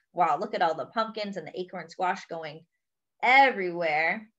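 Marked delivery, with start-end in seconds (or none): none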